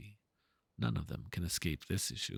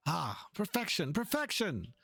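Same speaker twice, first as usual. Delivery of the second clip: rising to a high, thrilled cry of perfection; delighted exclamation; 9 semitones higher than usual. Acted. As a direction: gush over the photo as if it's a masterpiece. Delighted artist admiring a photo.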